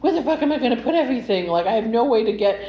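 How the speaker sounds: high, rushed, frantic question; retold panic, fast and high; freaking out. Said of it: "So I'm already freaking out".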